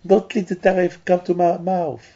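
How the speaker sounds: high, begging plea; pleading mouth. Pretend. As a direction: beg in a high voice like a frightened mouth. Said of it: "The mouth is screaming".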